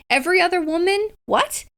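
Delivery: high, scoffing "what?" in the hero's voice; mock incredulous. Hero brushing off other women, mock incredulous. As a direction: toss off other women as if the question is absurd. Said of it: "He's like".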